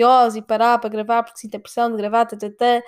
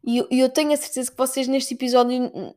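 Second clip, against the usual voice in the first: ordinary delivery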